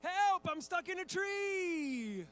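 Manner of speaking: high, loud, very drawn-out mock yell; child's cry for help. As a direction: holler like a stubborn little boy, stretching the last word. Son ignores dad, calls again.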